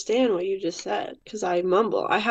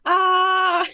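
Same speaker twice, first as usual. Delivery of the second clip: scream; 10 semitones higher, 4 dB louder than usual. Retold or imitated. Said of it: "I start screaming".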